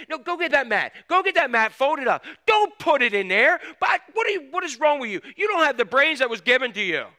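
put-on loud, high scolding of a child; angry dad yelling. Father scolding his young son at the landfill, loud angry yelling. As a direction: snap at the kid like an exasperated father. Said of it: "He went".

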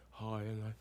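very soft, low mock-serene voice; mellow movie Jesus. Preacher mocking Hollywood's Jesus, mellow and hushed. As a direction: barely voice it, mock-gentle. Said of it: "mellow and".

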